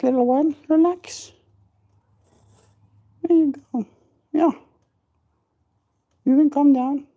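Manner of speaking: high cooing reassurance. Real, not acted. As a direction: coo high to calm the chicks. Calming baby birds.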